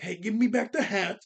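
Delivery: half-strength yell; imitated yell. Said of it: "Luffy does yell at her".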